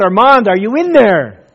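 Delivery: father calling loudly into the rubble. Retold or imitated. Father calling to his son under the rubble, loud call. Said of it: "And he cried out".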